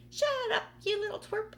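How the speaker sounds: very high, loud snap; snapping aunt. Acted. Aunt Spiker snapping at James for murmuring, high loud snap. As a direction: snap at the boy to shut up. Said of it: "Aunt Spiker snapped".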